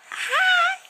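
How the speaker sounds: very high squeaky hi. Real, not acted. Greeting baby brightly.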